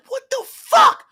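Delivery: high, stretched-out shocked cry; shocked disbelief. Retold or imitated. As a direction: react in utter disbelief. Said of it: "I'm like".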